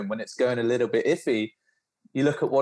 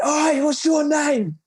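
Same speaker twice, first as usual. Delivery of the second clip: much higher, louder, slow bellow; booming shout; 4 dB louder, much slower than usual. Retold or imitated. Instructor demanding a recruit's name, booming shout. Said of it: "this voice just booms".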